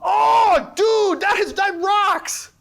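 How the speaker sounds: thrilled by a student's idea, shouting; excited shout. Real, not acted. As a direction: shout out real delight at the answer. Loves a student's answer.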